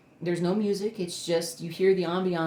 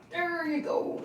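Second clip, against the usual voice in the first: pet talk; 12 semitones higher, slower than usual. Real, not acted. Coaxing the dog down.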